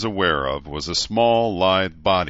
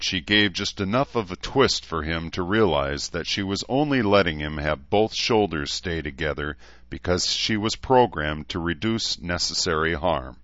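ordinary delivery, nothing marked

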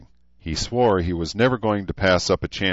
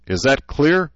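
demanding; 8 semitones higher, 5 dB louder than usual. Acted. Gunny demanding answer from formation.

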